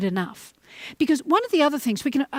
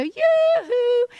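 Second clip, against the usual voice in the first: high, bright "yoo-hoo" called across the driveway; sing-song call; 12 semitones higher, 4 dB louder than usual. Retold or imitated. Aunt calling to her toddler nephew up the driveway, high sing-song call. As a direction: call out to the child in a lilting, high-pitched voice.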